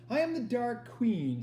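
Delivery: high, slow, grand theatrical proclamation; intoning Dark Queen. Acted. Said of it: "she intoned".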